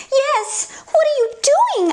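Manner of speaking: high prim book voice; Jane's high voice. Acted. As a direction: squeak it out as a fussy book. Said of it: "asked Jane".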